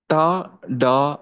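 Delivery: slow, drawn-out retroflex టా, డా; hard Telugu T and D. Shown as a demonstration. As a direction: stretch out the hard టా, డా that French lacks. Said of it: "టా, డా అనేవి ఫ్రెంచ్లో లేవు". (గట్టి టా, డా నెమ్మదిగా)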